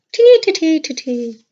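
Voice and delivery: a hint of a playful Irish accent, high chirpy "tee". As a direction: sing out "tee, tee" in a light Irish lilt. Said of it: "my Irish voice".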